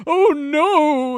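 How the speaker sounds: high, loud frightened cry; scared cry. Retold or imitated. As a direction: yelp it out in fright. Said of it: "And Shiva's like".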